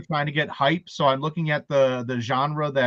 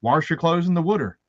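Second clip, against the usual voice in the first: raised-pitch Baltimore "warsh" and "wooder"; Baltimore accent; 4 semitones higher than usual. Shown as a demonstration. Showing how he used to talk, Baltimore accent. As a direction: lean into Baltimore vowels, R in WASH. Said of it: "that was just how I was".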